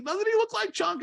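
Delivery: put-on taunting kid. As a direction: tease him like a kid in the crowd. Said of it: "he's like".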